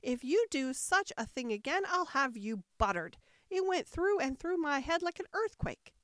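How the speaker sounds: loudly aggrieved threat and complaint; indignant cry. A narrator's reading. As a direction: scold him in a high, shaken voice. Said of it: "cried the poor king".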